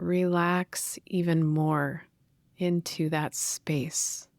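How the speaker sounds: guided meditation. Real, not acted. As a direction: gently guide listeners inward, leaving space to breathe.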